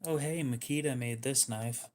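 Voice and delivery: ordinary talk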